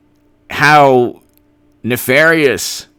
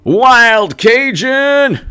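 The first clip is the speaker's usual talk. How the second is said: slow, high, stretched call of a name; drawn-out cheer for a caller; excited shout-out; 10 semitones higher, 3 dB louder, much slower than usual. Real, not acted. Calling out a fan's name.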